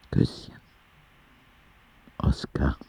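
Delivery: barely voiced sign-off, fading out; tired whisper. Boy signing his letter to God, whispered. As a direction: breathe the name like you're drifting to sleep.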